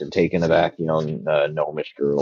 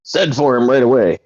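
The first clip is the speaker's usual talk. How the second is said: louder, higher-pitched order; commanding; 6 semitones higher, 8 dB louder than usual. Acted. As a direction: call out an urgent command in character. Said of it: "and he's like".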